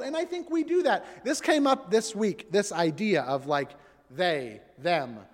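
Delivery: ordinary talk, nothing marked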